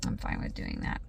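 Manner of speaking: breathy whispered repeat. Shown as a demonstration. Mom to herself, whispered repeat. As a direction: drop to a whisper. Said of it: "repeated in whisper".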